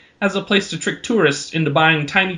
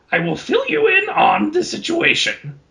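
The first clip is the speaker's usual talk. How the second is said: Yoda-like troll. Acted. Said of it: "sounds kinda like Yoda".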